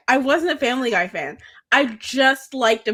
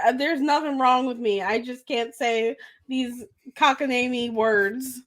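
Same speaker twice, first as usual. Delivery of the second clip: ordinary talk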